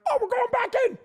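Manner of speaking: high, strained voice of sudden urgency; alarmed realization. Retold or imitated. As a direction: squeal it as the cramps return. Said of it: "I'm like".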